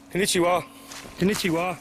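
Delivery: high called greeting; calling out. Real, not acted. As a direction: call the greeting across the trees. Calling out to distant man.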